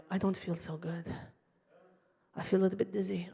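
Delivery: put-on soft, faint old father voice; imitated weak complaint; feeble ailing dad. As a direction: murmur it like you might keel over.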